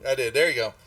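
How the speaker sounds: usual speaking voice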